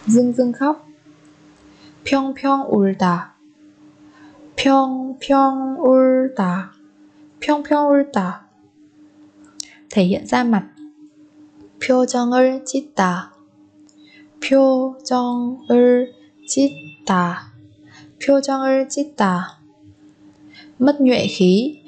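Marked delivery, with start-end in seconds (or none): 4.5-6.7 "평평 울다" slowly, syllable by syllable; drawn-out teaching model of "평평 울다". Shown as a demonstration. Teacher repeats phrase syllable by syllable. 14.4-17.5 "표정을 짓다" slowly, syllable by syllable; drawn-out teaching model of "표정을 짓다". Shown as a demonstration. Teacher repeats phrase syllable by syllable.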